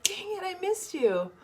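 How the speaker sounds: high-pitched playful lament; exaggerated high "shucks" delivery; mock disappointment. Voices viewer's disappointed message.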